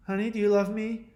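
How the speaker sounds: mock needy wife; imitated wife. Voices wife asking husband.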